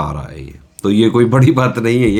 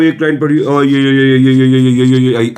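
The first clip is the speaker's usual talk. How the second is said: mock rushed skimming; 4 semitones higher, 4 dB louder than usual. Shown as a demonstration. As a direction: rattle off ये, ये, ये loud and fast like flipping pages. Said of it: "ऐसे नहीं करना है".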